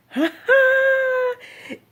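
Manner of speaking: long, high, loud squeal of excitement; excited squeal. Real, not acted. Patient sharing big treatment news, excited squeal.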